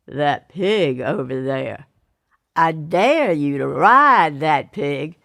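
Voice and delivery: slow, low teasing dare; sly dare. Acted. Mischievous brother daring his little sister, slow and low. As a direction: lure her into it slowly.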